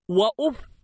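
وأف stopped on the f, "wa-uf"; sukun on fa. Shown as a demonstration. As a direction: say وأف as "wa-uf", no final vowel. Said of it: "بضم الألف وتسكين الفاء".

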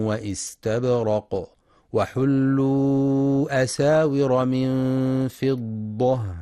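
slow, drawn-out melodic tartil; slow Quranic recitation. Acted. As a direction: chant the verse with measured tajweed.

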